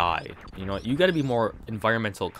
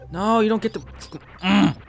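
straining shout; 10 semitones higher, 6 dB louder, much slower than usual. Real, not acted. Fighting enemy, loud.

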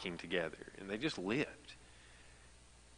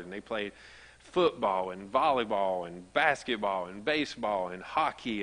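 ordinary talk, nothing marked

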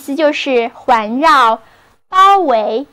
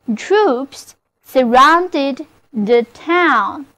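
slower repeat; much slower than usual. Shown as a demonstration. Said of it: "就是".

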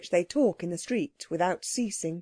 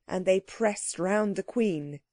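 usual speaking voice

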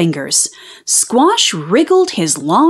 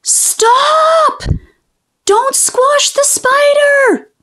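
very high, stretched-out squeal; desperate plea; much slower than usual. A narrator's reading. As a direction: beg in a squeaky, drawn-out cry. Urgent plea to spare spider.

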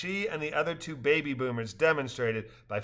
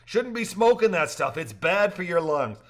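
higher, louder mock warning; fatherly scold; 5 semitones higher, 5 dB louder than usual. A narrator's reading. Rafter giving fatherly advice, higher mock warning. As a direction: play the caring dad.

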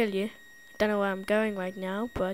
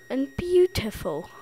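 ordinary delivery

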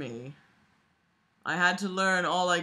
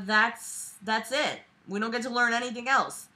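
normal talking voice

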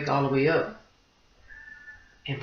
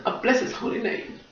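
praise; 6 semitones higher, 4 dB quieter than usual. Real, not acted. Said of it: "And I just bless him".

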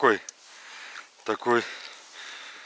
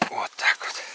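whispering; 7 dB quieter than usual. Real, not acted. Whispering in hunting blind.

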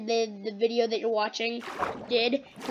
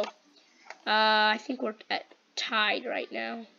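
ordinary talk

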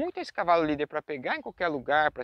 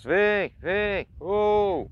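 loud, higher call to the horses; 3 semitones higher, 4 dB louder, much slower than usual. Shown as a demonstration. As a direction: call the herd home in a long sing-song. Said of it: "Eu uso o tom de voz". (chamado alto para os cavalos)

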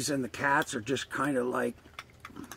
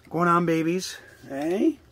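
raised, higher call to the babies; 5 semitones higher, 5 dB louder, slower than usual. Real, not acted. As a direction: call them over, cheerful. Calling to the raccoons.

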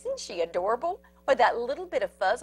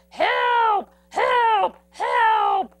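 high, loud panicky cries; frantic little critter voice; cry for help; 10 semitones higher, 6 dB louder, much slower than usual. Acted. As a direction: squeal for help in fright. Prairie dogs flee falling ball.

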